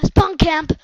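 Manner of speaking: loud yell; shouting about being spawn camped. Real, not acted. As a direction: yell the complaint out.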